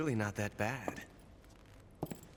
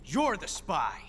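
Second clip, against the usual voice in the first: raised, pointed "Tatiana"; loud accusation; 10 semitones higher, 3 dB louder than usual. Acted. Ocelot unmasking Tatiana as the spy, loud accusation. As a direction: call her out loudly as the spy.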